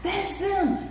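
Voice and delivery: high hushed gasp of fans spotting a celebrity; mock whisper of excited fans. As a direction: squeal it softly, trying to whisper. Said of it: "They was trying to whisper it".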